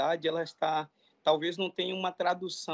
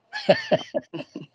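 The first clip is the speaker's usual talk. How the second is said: high, loud burst of laughter; real laugh, high and sudden; 6 dB louder than usual. Real, not acted. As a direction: laugh at your own punchline. Laughs after own punchline.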